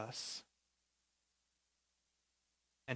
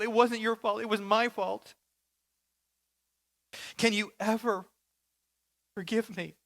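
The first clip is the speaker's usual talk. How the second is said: higher, pleading apology with a tightening throat; tearful rehearsed apology; 8 semitones higher, 3 dB louder than usual. Retold or imitated. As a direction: beg your father's forgiveness, voice catching. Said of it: "her throat tightening every time she rehearses them".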